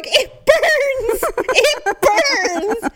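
high laughing cries of it burns; laughing mock pain. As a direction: wail it through laughter. Champagne splashed into her eye.

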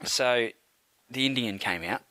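ordinary talk, nothing marked

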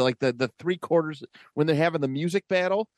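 ordinary delivery

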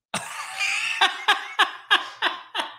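a burst of high, airy laughter; high breathy laugh. Real, not acted. Laughing at fans who think the Netflix show is him, high airy laugh. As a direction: laugh it off, unable to hold it in.

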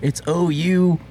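mock excited Texas-bound partiers; hyped movie dudes. Two dudes driving to Austin, rowdy and high-pitched.

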